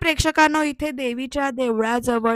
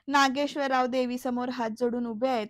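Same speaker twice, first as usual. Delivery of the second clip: ordinary delivery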